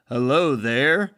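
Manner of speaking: imitating Clark Gable's unaccented greeting; no Southern accent. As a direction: say HELLO THERE plainly, with no Southern accent. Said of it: "Like, where's his accent?".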